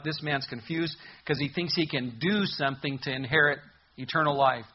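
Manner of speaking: ordinary talk, nothing marked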